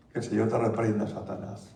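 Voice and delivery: low, soft rebuke fading to a whisper; muttered rebuke. Retold or imitated. Stingy brother rebuking the offering call, low and whispered. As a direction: push the devil away under your breath.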